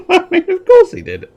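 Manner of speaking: high, loud, laughing exclamation; laughing disbelief. Real, not acted. Streamer reacting to Loki's antics, laughing incredulously. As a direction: say it through a burst of laughter.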